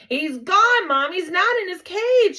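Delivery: put-on high, loud boy's alarm; alarmed shout. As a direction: shout to drag mom in. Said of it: "Simon shouted".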